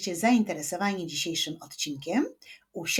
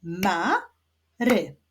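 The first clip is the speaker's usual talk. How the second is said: "Mary" as MA... RY, two separate beats; "Mary" split, rising ma then falling ry; syllable by syllable. Shown as a demonstration. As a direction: clap out "Mary" with a pause after ma. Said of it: "wyklaszczmy sylaby w wyrazie mary".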